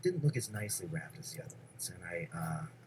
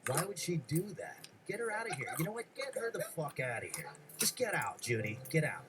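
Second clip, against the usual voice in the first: put-on higher, whiny five-year-old yelling at the gift; bratty kid tantrum; 8 semitones higher than usual. Five-year-old Henry ranting to his mom about the puppet, bratty tantrum. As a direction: act out a spoiled brat throwing a fit.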